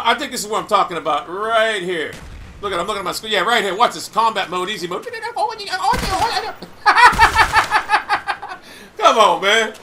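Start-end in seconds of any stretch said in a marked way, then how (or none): 5.1-8.6 gleeful cackle; long high laugh. Real, not acted. Laughing at how easy the kill was, a long high laugh.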